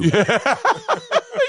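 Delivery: loud, high-pitched laugh; burst of laughter. Real, not acted. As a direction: crack up at the joke. Guest laughs at host's joke.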